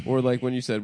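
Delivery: usual speaking voice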